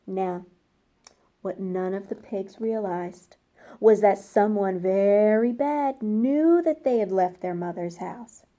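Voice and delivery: plain reading voice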